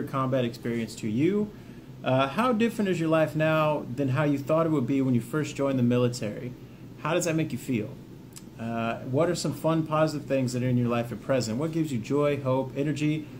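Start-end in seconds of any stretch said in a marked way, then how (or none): none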